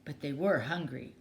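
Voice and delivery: ordinary delivery, nothing marked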